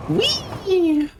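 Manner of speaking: high squealing whee; gleeful whee. Real, not acted. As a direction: squeal with delight. Gleeful cry while falling.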